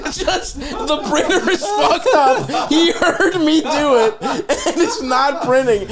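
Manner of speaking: slow, high, laughing it out; can barely get it out for laughing; laughing through the excuse. Retold or imitated. Telling friends about lying to his friend, laughing.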